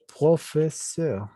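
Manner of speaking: "profesor" syllable by syllable, pro-fe-seur; slow French model. Shown as a demonstration. Teacher modelling the word, slow French syllables. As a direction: stretch "profesor" with French R and EU ending. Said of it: "Él puede decir".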